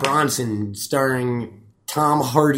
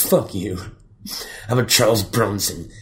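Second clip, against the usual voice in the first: lightly voiced, lower prisoner drawling his new name; Bronson impression; 4 semitones lower, slower than usual. Host acting out the prisoner choosing his fighting name, lightly lowered and slow at the end. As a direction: savour the name slowly like the tough prisoner. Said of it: "he talks like this the entire film".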